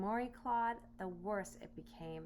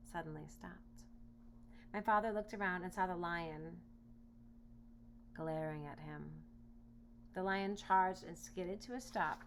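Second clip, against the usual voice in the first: plain reading voice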